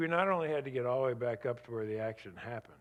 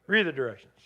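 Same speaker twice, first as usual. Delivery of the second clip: ordinary talk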